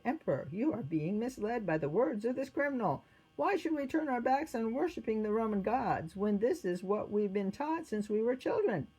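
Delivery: insistent appeal to the emperor; objecting guard. A narrator's reading. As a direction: plead against being misled.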